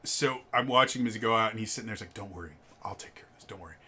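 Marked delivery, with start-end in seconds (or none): 2.1-3.8 much softer, low reassurance to the daughter; quiet reassurance. Retold or imitated. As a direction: murmur that he'll handle it. Dad reassures daughter leaving.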